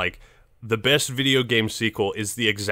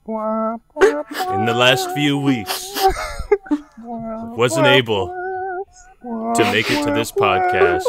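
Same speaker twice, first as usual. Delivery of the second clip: mock crying; 11 semitones higher, slower than usual. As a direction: fake sobbing, voice breaking. Mock eulogy for Late Night.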